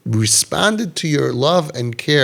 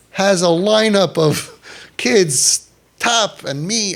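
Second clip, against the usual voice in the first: higher, slow, with a laugh; slow, much higher resentful laugh; bitter incredulity; 7 semitones higher, much slower than usual. Retold or imitated. Father complaining, voiced.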